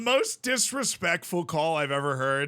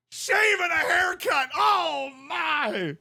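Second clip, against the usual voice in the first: Musburger call; 11 semitones higher, slower than usual. Retold or imitated. Retelling the dunk call, shouting announcer voice. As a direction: yell it wildly after a dunk. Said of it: "That's the call".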